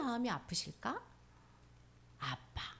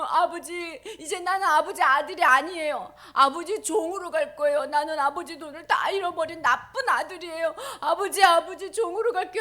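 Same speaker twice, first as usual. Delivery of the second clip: tearful pleading son; 7 semitones higher, 10 dB louder than usual. Acted. Son rehearses begging father.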